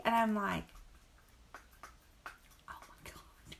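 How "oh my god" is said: breathy whisper of sudden recognition; whispered realization. Retold or imitated. Son reminds her of the donkey, she whispers. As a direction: let it slip out as a whisper.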